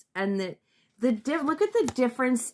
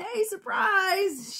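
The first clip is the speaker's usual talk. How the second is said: high, slow sing-song "surprise!"; hammed-up party pop-out cheer; mock surprise; 12 semitones higher, slower than usual. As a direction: leap out and spring it on them. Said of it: "jump out of fucking corners and say".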